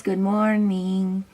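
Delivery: slow, drawn-out cartoon voice for Frida; voicing Frida. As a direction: stretch it out in a silly put-on voice. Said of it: "Frida says".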